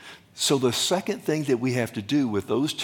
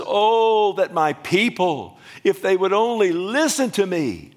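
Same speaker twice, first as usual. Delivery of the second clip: cry out; 7 semitones higher, 6 dB louder than usual. Retold or imitated. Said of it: "he cried out".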